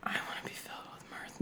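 fast breathy whisper. Pretend. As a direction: hush it out quickly. Echoes friend in a whisper.

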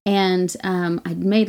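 usual speaking voice

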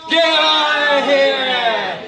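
very slow, each word spaced; firm slow rebuke. Shown as a demonstration. Preacher showing the church how to rebuke the devil, slow. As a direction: drive it home slowly. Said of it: "look him squarely in the eye and say".

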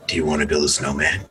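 low, sinister horror-villain line; Jigsaw voice. Pretend. Imagining approaching a random girl, low and sinister. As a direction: menace the stranger like a horror villain. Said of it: "not in the Jigsaw voice".

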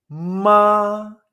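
"ma" held long, high and level, loud; first tone. Shown as a demonstration. Teacher models Mandarin first tone for Spanish speakers, "ma" high and flat. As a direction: sustain "ma" on one high, steady note. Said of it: "primer tono".

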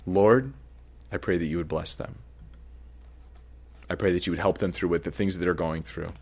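a man praying for someone who hurt him, softly; soft prayer. Acted.

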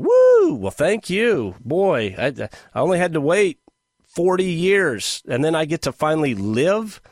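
hammed-up, ironic delight; sarcastic celebration. Mock gratitude for retiring at sixty-seven.

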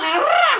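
high-pitched shriek of exasperation; frustrated scream. Acted. Coach wanting to shake people awake, exasperated shriek. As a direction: burst out in a mock scream.

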